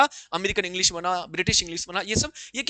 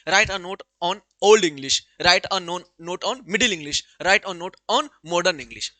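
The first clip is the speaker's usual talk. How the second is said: measured dictation pace for the class; slow, deliberate textbook reading; slow reading aloud; 2 semitones lower, 5 dB louder, much slower than usual. Acted. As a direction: spell it out word by word for students. Dictates exam questions.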